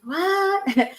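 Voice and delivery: high-pitched, startled question; mock-startled, pitched right up; retold surprise. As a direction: react with a drawn-out, high "what". Retells reaction to tearful call.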